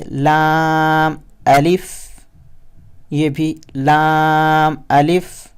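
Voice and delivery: spelling letters slowly, carefully; slow recitation. Shown as a demonstration. Teacher spelling letters slowly for students. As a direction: read it out slowly for learners. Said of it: "ہم اسے پڑھیں گے".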